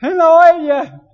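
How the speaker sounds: hammed-up high, loud cry of horror; family joking about the pastor's stew, shouting high and slow; mock family shout. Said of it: "Mi familia grita".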